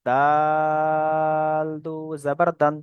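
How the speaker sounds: দাল held very long on an even tone; three-alif stretch. Shown as a demonstration. Teacher demonstrating the long letter দাল, drawn-out recitation. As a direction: slowly stretch the "আ" of দাল before দু জাবার দান. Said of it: "দালটাও তিন আলিফ পরিমাণ টান".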